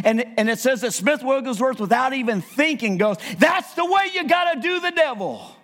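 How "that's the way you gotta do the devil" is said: high, forceful outburst; fired-up preacher's cry; emphatic exclamation. Acted. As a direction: blurt it out as a sudden revelation. Acts out Wigglesworth's sudden exclamation.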